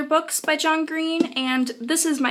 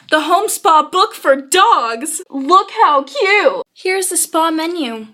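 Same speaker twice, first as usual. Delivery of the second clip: high, loud, slow gush over the book; 4 semitones higher, 5 dB louder, slower than usual. Real, not acted. Vlogger squealing to viewers over a dog book. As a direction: drag it out like squealing over a puppy.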